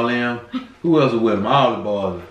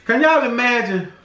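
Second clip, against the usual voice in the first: excited; 10 semitones higher than usual. Real, not acted. Worked up preaching joke.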